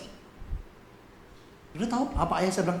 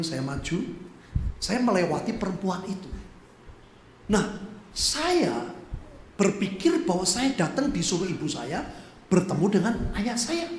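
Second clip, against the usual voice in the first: ordinary delivery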